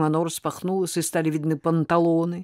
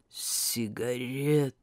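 slow, lower, languid command; languid rolling voice; 4 semitones lower, 6 dB quieter, much slower than usual. Acted. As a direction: drawl it like a tired diva. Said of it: "томными перекатами голоса".

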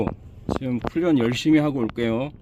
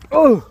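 startled yelp; 13 semitones higher, 10 dB louder than usual. Real, not acted. Sudden exclamation.

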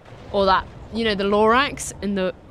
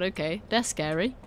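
usual speaking voice

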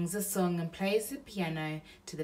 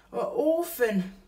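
burglar voice; 7 semitones higher, 7 dB louder than usual. Acted. Bill pities the baby.

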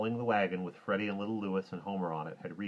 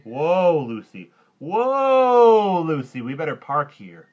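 loud, high drawn-out horse call; big boyish shout to halt; shouting whoa; 9 semitones higher, 16 dB louder, much slower than usual. Acted. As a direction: holler to stop the horse, then ease down. Boy halts the horse.

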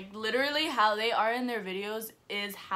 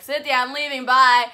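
loud, higher-pitched hurried goodbye; retold calling out; 4 semitones higher, 15 dB louder than usual. Telling friend she's leaving the hallway, calling out loudly. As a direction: call it out loudly on the way out.

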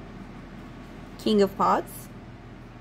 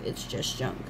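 breathy whisper, barely sounded; 9 semitones lower, 9 dB quieter than usual. Retold or imitated. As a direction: mutter it under your breath like Sarah. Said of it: "I also related to Sarah".

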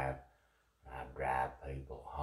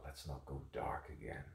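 ordinary talk

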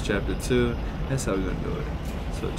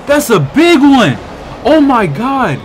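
much higher, much louder alarmed yells; panicked screaming; 13 semitones higher, 8 dB louder, much slower than usual. Real, not acted. Gamer facing a huge monster, screaming in panic. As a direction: genuine panic at a giant enemy.

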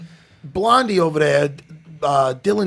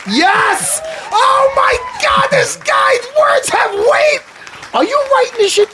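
much higher and louder, slowed-down shout of mock awe; hammed-up amazement, yelled high; mock excited shout; 4 dB louder, slower than usual. Mock awe at friend's phrase.

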